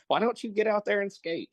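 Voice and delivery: very high-pitched mock nagging; Ken Hitchcock. Guest mimics a hockey coach chiding a player, high-pitched voice. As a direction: needle a player in a squeaky coach voice. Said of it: "he has this really high-pitched voice".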